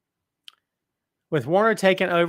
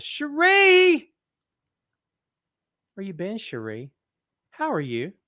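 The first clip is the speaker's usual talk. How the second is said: calling out a name; 4 semitones higher, slower than usual. Real, not acted. Host spotting a regular viewer in chat, high calling out. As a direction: hail her like a long-lost friend.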